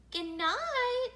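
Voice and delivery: high, innocent singsong goodnight; sweetly. A narrator's reading. Boys innocently seeing father off at bedtime, sweetly. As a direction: lilt it like angelic children. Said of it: "said the boys sweetly".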